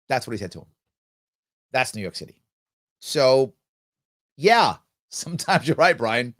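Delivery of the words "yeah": ordinary delivery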